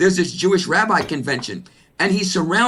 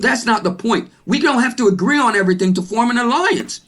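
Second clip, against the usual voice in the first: impassioned, high-pitched argument climbing higher; excited; 6 semitones higher than usual. Real, not acted. Speaker urging an alliance despite disagreements, excited. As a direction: build excitedly to a high peak. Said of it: "I'm excited about the film".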